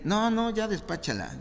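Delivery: high, brushing-off "no, no"; impatient dismissal. Acted. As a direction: wave her away impatiently. Disciples dismissing the woman.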